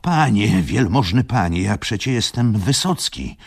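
lightly voiced humble worker, pleading; pleading railwayman. A narrator's reading. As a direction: beg the gentleman to recognise you. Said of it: "zawołał".

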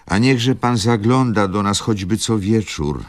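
put-on an old lady calling after departing guests. A narrator's reading. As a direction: call after them down the hall. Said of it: "zawołała sędziwa dama".

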